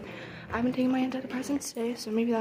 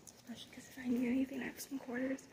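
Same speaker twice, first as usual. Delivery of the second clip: whispering; 9 dB quieter, faster than usual. Real, not acted. Quiet talk in school hallway.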